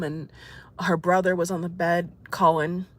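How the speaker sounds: ordinary talk, nothing marked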